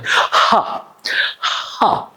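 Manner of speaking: ha ha pushed from the belly; breathy h demo. Shown as a demonstration. Teacher demonstrating h for the class. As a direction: force air out on each ha. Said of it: "and you do it".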